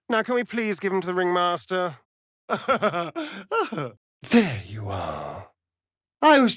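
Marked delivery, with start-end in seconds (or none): 2.5-3.9 laugh. Acted. Laughs before a tease.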